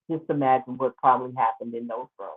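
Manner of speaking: ordinary talk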